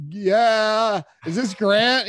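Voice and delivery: freaking out. Retold or imitated. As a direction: blurt it out, star-struck and thrilled. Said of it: "You know, I was freaking out".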